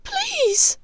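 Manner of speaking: very high, shrieking please; desperate plea. Acted. Hermione begging Bellatrix, high and desperate. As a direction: beg for mercy.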